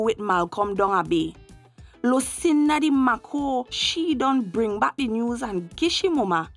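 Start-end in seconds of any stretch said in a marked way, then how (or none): none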